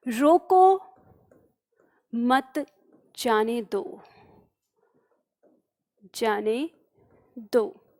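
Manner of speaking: "रोको", "मत", "जाने दो" set apart by long pauses; dictation-like gaps between the words. Shown as a demonstration. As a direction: read it out piece by piece, falling away on "जाने दो". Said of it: "अगर इस वाक्य के अंदर मैं एक अल्प विराम लगा दूं".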